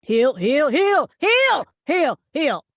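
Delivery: loud commands; barking orders at seven dogs; calling dogs. Acted. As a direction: shout heel at the pulling puppies. Megan commanding unruly dogs.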